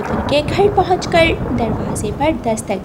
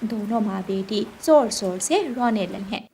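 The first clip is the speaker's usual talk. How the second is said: plain reading voice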